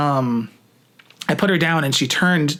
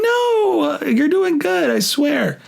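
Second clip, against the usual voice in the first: reassuring Taylor; 13 semitones higher, much slower than usual. Retold or imitated. Imitating Taylor reassuring him.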